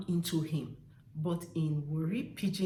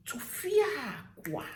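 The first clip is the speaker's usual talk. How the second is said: TUFIAKWA drawn out, high on "fia", falling away; demonstrated exclamation; 6 semitones higher, slower than usual. Shown as a demonstration. Showing viewers how Tufiakwa is said, drawn out with feeling. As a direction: stretch the middle of Tufiakwa, then drop. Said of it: "use your hand to demonstrate it".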